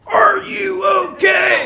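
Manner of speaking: slow, high, booming shout; imagined drill-sergeant shout. Retold or imitated. Dad as the scared son hears him, slow loud bellow. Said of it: "but I heard".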